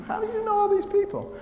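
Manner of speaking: squeaky, very high mock disbelief; astonished. Hospital colleagues asking about all his friends, high-pitched astonishment. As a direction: imitate a colleague who can't believe it.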